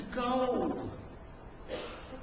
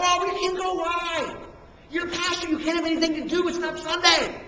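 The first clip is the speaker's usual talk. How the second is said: higher, incredulous mock-buddy voice; teasing disbelief; 6 semitones higher, 4 dB louder than usual. Pastor buddies mocking his excuse, teasing disbelief. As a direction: rib him with raised pitch.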